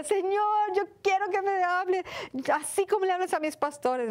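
high, pleading cry to God. Retold or imitated. Crying out to God, retold. (súplica aguda al Señor)

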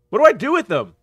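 high, loud baffled questioning; exasperated. Real, not acted. Player baffled by what to do with them, exasperated. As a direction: voice jumps up in loud bewilderment.

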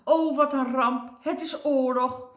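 high, loud frightened wailing; panicked cry. Acted. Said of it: "riep meneer Doodsbenauwd".

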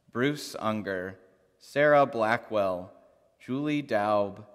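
normal talking voice

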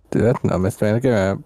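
loud laughing through words; cracking up mid-sentence. Real, not acted. Officer breaks character laughing.

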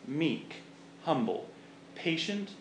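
usual speaking voice

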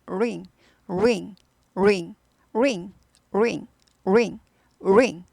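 slow "링" from pursed "우" lips; 우 입모양 ring; rounded r drill. Shown as a demonstration. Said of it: "입 모양을 우부터 시작하면 됩니다".

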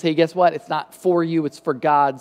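ordinary delivery

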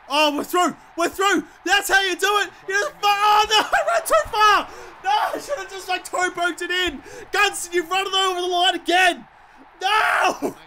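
high yell, triumph collapsing into a wail; celebrate, then howl at your own blunder; real frantic gamer outburst; elated shouting turning to dismay. Real, not acted. Joy collapses after running too far.

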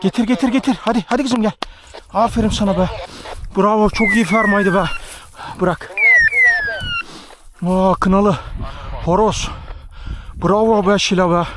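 talking to a dog. Real, not acted. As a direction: cheer the dog on to fetch. Calling dog to retrieve bird.

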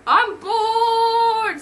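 loud, high, drawn-out complaint; bored whine. Acted. Doctor Strange complaining to Thor by phone, bored whine. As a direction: whine it out loudly like a bored kid.

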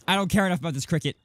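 usual speaking voice

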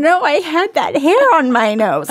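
loud, high-pitched playful dismay; hammed-up shocked discovery; mock horror. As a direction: exclaim in comic alarm at your reflection. Acting horror at magnified mirror view.

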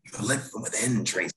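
heightened, theatrical movie quote; Jimmy Stewart, very dramatic. Retold or imitated. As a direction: play it as an old-film romantic lead, overdramatic. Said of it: "He's very dramatic".

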